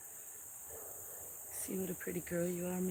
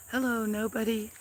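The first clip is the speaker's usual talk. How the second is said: usual speaking voice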